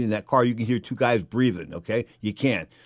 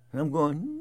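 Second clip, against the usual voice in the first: normal talking voice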